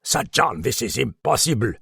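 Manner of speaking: mildly sharp Frenchman's retort; a touch of irritation; snapped objection. A narrator's reading. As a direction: snap it with restrained impatience. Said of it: "the Frenchman snapped".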